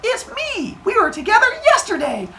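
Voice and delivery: hammed-up flirty nymph; flirty Maya. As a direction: remind him coyly of last night. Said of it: "And Maya's like".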